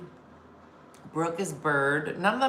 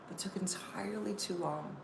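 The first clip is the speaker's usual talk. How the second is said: whispered aside to the crowd; hushed, unvoiced repeat of the punchline; 12 dB quieter than usual. Shown as a demonstration. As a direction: breathe it as a secret.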